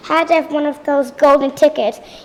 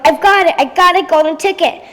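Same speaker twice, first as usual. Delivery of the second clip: raised, higher cry of triumph; retold excited yell; 3 semitones higher, 4 dB louder than usual. Veruca imitates the worker finding the ticket, excited yell. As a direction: call it out like a lucky finder. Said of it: "one of his woman workers yelled".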